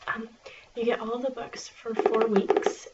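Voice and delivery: ordinary talk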